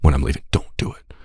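whispered embarrassed plea; hushed plea. Retold or imitated. Daughter begging dad in front of friends, hushed. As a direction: beg him quietly in front of friends. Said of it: "she goes".

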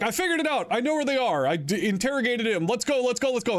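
high, eager rush to leave; excited detective. Retold or imitated. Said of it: "he's like".

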